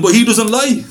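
high anxious insistence; worried enemy, comic; mock worry. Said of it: "He goes".

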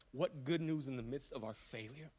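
ordinary talk, nothing marked

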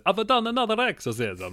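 high, loud mock Italian accent; Russell Crowe's Italian accent. Host mimics an exorcist pleased with himself, exaggerated Italian accent. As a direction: ham up a proud Italian accent, pitched high. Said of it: "very good impression of his Italian accent".

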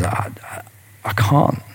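barely voiced, pleading in a whisper; a scared servant whispering; frightened whisper. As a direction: shrink away from the king as you beg. Servant begs the king he can't pay.

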